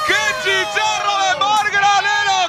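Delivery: fast, loud goal call; breakneck goal commentary. Acted. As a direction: race through the play and explode on the goal.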